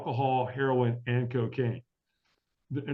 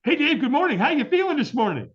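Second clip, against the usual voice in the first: much higher, louder, bright-and-early chirp; cheery morning greeting; 6 dB louder than usual. Retold or imitated. AA old timer calling the hungover newcomer, chirpy. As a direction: sing out a chipper hello. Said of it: "John's like".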